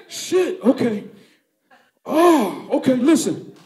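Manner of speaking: high, loud, dazed and laughing; orgasm-stunned man. Retold or imitated. Suspect hit by the O gun, high dazed blissful moaning. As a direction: melt down blissed out and woozy.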